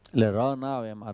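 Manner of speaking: ordinary talk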